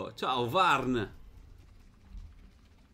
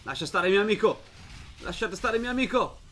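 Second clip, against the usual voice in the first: loud, higher call to leave his friend alone; protective shout; 8 semitones higher, 4 dB louder than usual. Real, not acted. Gamer shouts at the enemy to leave his friend. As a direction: yell to protect your ally. Defending ally Purri in fight.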